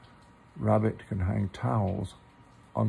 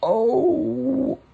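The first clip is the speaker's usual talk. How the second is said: pained cry; 8 dB louder than usual. Acted. Pooh pulled from hole.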